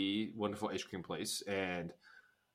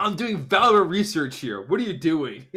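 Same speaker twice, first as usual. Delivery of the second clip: higher and louder, acting put-out; imitating Robin scolding Dustin, higher and louder; mock indignant Robin; 6 semitones higher, 8 dB louder than usual. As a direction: play the annoyed big sister, hammed-up. Robin snapping at Dustin, retold.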